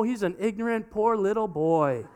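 ordinary talk

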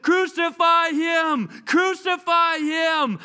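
angry mob chant; crowd shouting. Acted. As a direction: yell it at his face. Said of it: "stood in his face and said".

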